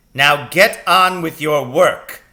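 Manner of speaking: loud, slow, high stern teacher; dreaded voice. A narrator's reading. Dreaded teacher commands the class back to work, loud. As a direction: boom it at the class. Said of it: "the dreaded voice said".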